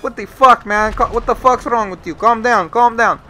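forceful calm-down order; exasperated friend. Retold or imitated. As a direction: imitate a friend urgently telling you off. Friend telling him to calm down.

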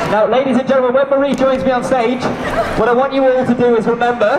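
a hint of circus announcer; showman announcement. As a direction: announce it to the whole square. Showman addressing crowd.

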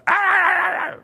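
long high-pitched loud "aah" wail; high sustained yell of mock turmoil; frantic wail. Shown as a demonstration. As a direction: cry out as if losing it. Mimics being torn in indecision.

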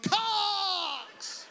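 high, loud, drawn-out shout; idiot's answering yell. Acted. One idiot answering a stranger's chant in public, loud high yell. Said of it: "one idiot would be like".

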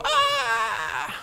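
high wail of hurt; hammed-up pained shout; rejected man's mock cry; mock anguished cry. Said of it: "And you go".

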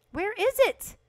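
high, loud frustrated question; frustrated near-wail; exasperated demand. Real, not acted. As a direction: cry out for the thing you can't find. Still hunting for missing filth.

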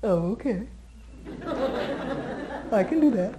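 lightly voiced dog, amused; voicing the dog. Trainer voicing a dog that gives in: light amused voice. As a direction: play the dog, a little laughing. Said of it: "half the dogs in the world will do this".

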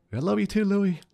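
high, strained, tearful voice; father telling his son he loves him, crying; voice breaking. Real, not acted. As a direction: push the words through tears. Answering son, emotional.

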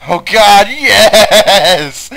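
loud, high, hammed-up ecstatic cry; over-the-top mock pleasure, loud and high; mock ecstatic moan. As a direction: ham up a moaning climax. Mock ecstasy over game scene.

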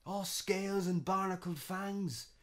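barnacled fangs cried out high and loud; horrified shriek. Acted. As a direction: scream "barnacled fangs" in horror. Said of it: "he cried".

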